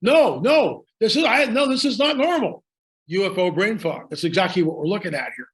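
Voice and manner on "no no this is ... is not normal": imitating a flustered man, high-pitched and halting; struggling for words. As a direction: stumble through a high, swooping mock protest. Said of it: "trying to get the words out".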